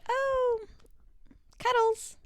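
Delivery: put-on high, breathy-free baby coo, last word whispered; cutesy cuddles voice. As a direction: coo the word like a doting partner. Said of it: "Would you say".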